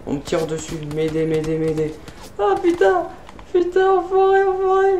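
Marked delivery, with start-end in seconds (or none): 2.4-5.0 cursing way up in a high panic; panicked high cursing. Real, not acted. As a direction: squeal the curses as you get hit. Under fire, taking hits.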